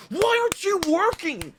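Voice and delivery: very high mock child's wail; hammed-up high-pitched kid complaint; distraught child. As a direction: imitate a child upset at the TV. Voices child punching the TV.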